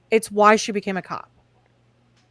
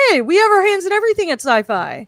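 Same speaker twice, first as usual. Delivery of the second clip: mock studio exec voice; 8 semitones higher, 5 dB louder, faster than usual. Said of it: "They said".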